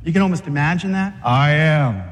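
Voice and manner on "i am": lower, a swaggering "I am"; swaggering Jesus. Retold or imitated. A preacher voicing Jesus meeting the arresting crowd, swaggering. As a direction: stand tall and own it, a hint of swagger. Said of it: "He had the man swagger too".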